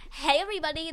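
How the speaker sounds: ordinary delivery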